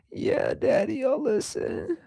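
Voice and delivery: put-on high, soft child voice; little daughter. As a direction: imitate a meek little girl giving in to dad. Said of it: "And she's like".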